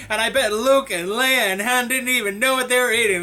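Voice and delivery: usual speaking voice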